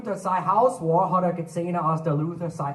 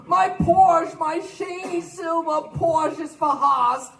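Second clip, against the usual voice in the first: high loud anguished cry, dragging slowly; wailing over his Porsche; 11 semitones higher, 4 dB louder, much slower than usual. Acted. Rich man from Philadelphia crying out over his Porsche, high and loud. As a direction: howl over the wrecked car. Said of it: "hat er gekriegt".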